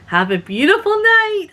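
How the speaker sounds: high, sing-song sarcastic farewell; sarcastic sweetness. Retold or imitated. Leaving the campsite, sarcastic sweet goodbye. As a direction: kill her with fake kindness. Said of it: "I said".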